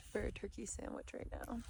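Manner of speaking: breathy whispered aside. Real, not acted. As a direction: sigh it out, wishing for food. Tired wistful aside.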